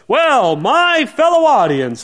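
hammed-up smooth talker opening a speech; polished orator. Speech maker addressing his ancient audience, grand oratory. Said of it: "you had the speech makers who go".

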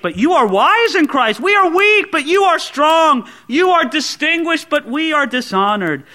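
high, loud preaching of the verses; fervent scripture reading. Acted. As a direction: proclaim the verses with rising fire.

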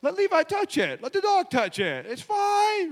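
high, slow, airy mock wife voice; mock carefree wife. Pastor shows how his wife did not answer, high mock carefree. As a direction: play her as a ditzy, carefree wife. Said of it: "She didn't say".

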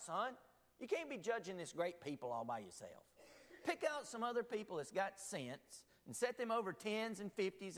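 a hint of Sand Mountain country talk; Sand Mountain accent. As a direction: play Jethro as a Sand Mountain country man. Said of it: "if he was from Sand Mountain".

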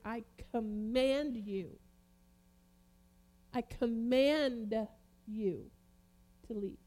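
slow, measured command. Retold or imitated. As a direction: order a spirit out, drawing the words out slowly. Jesus commanding the spirit, acted.

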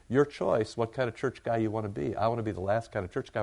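ordinary talk, nothing marked

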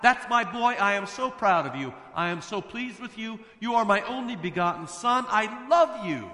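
high, loud proud father's call; joyful cry. Acted. Heavenly Father crying out proudly to his Son. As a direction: call out to your son, overflowing with pride. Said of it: "the Heavenly Father cries out".

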